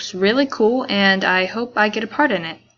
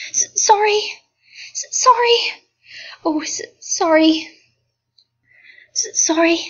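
much higher, stammering apology; flustered, caught off guard; startled; 9 semitones higher, 4 dB louder than usual. Acted.

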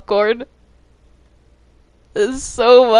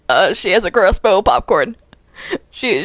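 ordinary delivery

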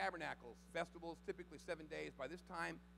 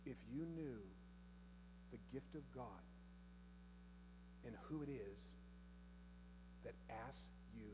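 gentle; 4 semitones lower, 12 dB quieter than usual. Retold or imitated. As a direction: lean in and let each phrase hang. Jesus answers softly, slow pauses.

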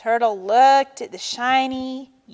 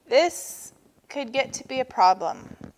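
plain reading voice

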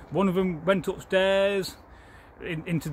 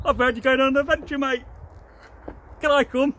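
higher, louder eager squirrel calling down; a cheeky squirrel shouting down from the branches; 7 semitones higher, 4 dB louder, faster than usual. Acted. Squirrel calling down to Jack, higher and louder.

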